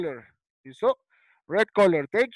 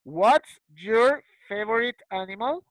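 slow, word-by-word "What's your favorite animal", rising at the end; slow model question; slower than usual. Shown as a demonstration. Teacher models the question for pupils, slowly and rising. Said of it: "Yo pregunto".

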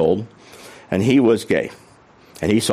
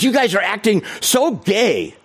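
mock angry rant, higher pitched; a hint of an angry Texan boater; angry accusation; 8 semitones higher than usual. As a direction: imitate the furious neighbour lightly. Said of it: "he started in".

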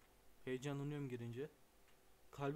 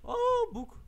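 short shriek, far higher and louder; jolted by a sudden scare; shriek of surprise; 11 dB louder than usual. Real, not acted. Player jolted by something in the game, a shriek.

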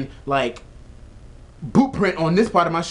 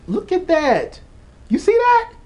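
climbing much higher and louder; disgusted excitement; 4 dB louder, slower than usual. Real, not acted. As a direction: shove the dirty shoe at the camera. Showing the grime to viewers.

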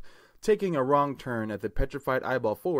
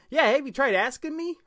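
squeaky high mouse voice; 13 semitones higher than usual. Acted. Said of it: "the dwarf said like a mouse".

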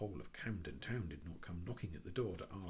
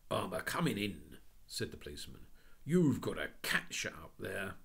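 policeman; 6 dB louder than usual. Acted. Policeman insisting.

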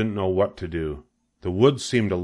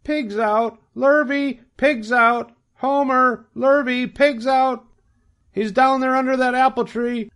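very high, loud holler; shouting for help; 4 dB louder, slower than usual. A narrator's reading. Farm wife shouting for the men, high alarmed yell. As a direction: call out shrilly across the farm. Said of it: "she immediately shouted for the men".

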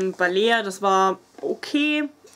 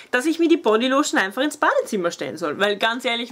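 ordinary talk, nothing marked

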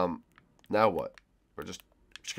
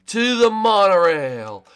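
grand proclamation; 9 semitones higher, 10 dB louder, much slower than usual. Real, not acted. Rallying while playing.

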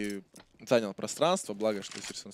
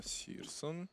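ordinary talk, nothing marked

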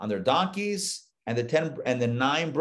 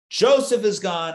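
anguished cry; 6 semitones higher, 5 dB louder than usual. Acted. Grieving father Jacob cries out.

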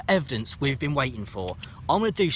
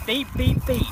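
detector beeps; 8 semitones higher than usual. Pretend. Imitating the detector beeping.

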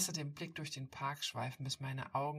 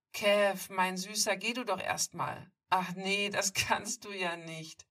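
high, louder, mocking sweet talk with a laugh; amused bully mocking with a laugh; amused mockery; 5 semitones higher, 10 dB louder, slower than usual. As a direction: taunt him like a sneering bully enjoying himself. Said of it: "sagt Brett belustigt".

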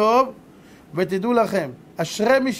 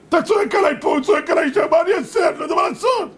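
desperate complaining shout; 8 semitones higher than usual. Acted. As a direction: cry out that he is stuck. Messenger caught between two shouters.

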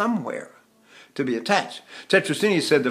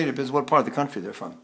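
ordinary talk, nothing marked

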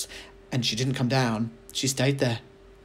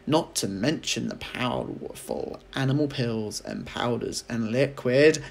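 plain reading voice

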